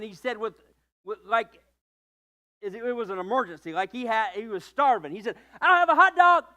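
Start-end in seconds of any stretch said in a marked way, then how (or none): 5.6-6.4 put-on high, loud eager child's voice; squealing little boy desperate for a hot dog; excited little boy. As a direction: blurt it out like a starving kid. Starving boy blurts his order.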